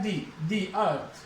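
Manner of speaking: the earth with the said dee, long e, slowly. Shown as a demonstration. Teacher showing the before a vowel: slow dee. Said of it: "the बोला जाएगा इसको".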